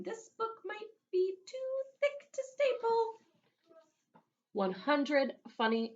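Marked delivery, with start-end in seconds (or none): 0.0-3.2 high, lightly voiced kid thinking aloud; a hint of a puzzled boy; Ralph musing.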